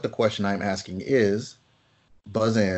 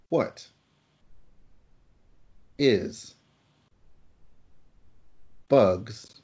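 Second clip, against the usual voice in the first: long pauses between each word; slow quiz read, word by word; one word at a time; 4 dB quieter, much slower than usual. Shown as a demonstration. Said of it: "I will ask these questions one word at a time".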